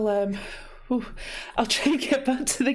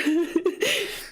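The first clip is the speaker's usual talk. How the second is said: tearful laugh; 5 semitones higher than usual. Real, not acted. Laughing through happy tears.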